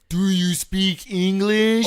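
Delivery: put-on loud, slow drunk slur; sloppy drunk yelling at a stranger; belligerent drunk. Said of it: "and goes".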